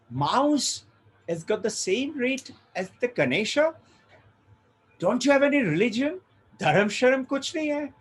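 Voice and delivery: acted outrage, pitched high; indignant rage. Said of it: "businessman flies into rage".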